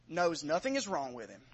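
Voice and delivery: ordinary talk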